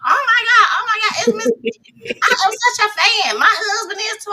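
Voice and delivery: high, loud starstruck fan squeal; imitated screaming. Guest meeting the host she admires, imitated screaming. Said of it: "just literally screaming out".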